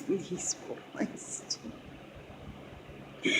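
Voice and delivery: whisper. Real, not acted. Mom confiding to viewers about her boys, whispered.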